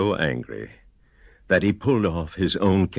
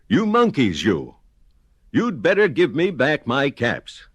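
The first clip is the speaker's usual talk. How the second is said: angry; 6 semitones higher than usual. Acted. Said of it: "Now he felt quite angry".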